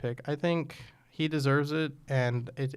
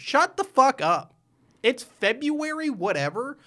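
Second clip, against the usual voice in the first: loud, slow, higher-pitched exasperated outburst; angry rant; 9 semitones higher, 4 dB louder, slower than usual. Real, not acted. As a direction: really vent at the critics. Ranting at online fans.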